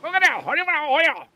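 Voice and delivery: retelling his own flustered stop; high hurried. Retold or imitated. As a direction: pitch it up, flustered. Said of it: "And I was like".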